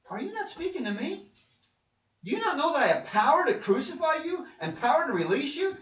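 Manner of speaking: affronted, high-pitched questions; indignant questioning. Acted. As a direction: read it as a ruler challenging a silent prisoner. Reads Pilate's questioning of Jesus.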